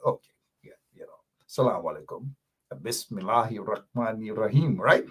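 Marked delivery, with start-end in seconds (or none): 2.7-4.8 measured, practised Arabic pronunciation of "Bismillahir Rahmanir Rahim"; linguist-style Arabic. Shown as a demonstration. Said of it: "makes me sound like a linguist".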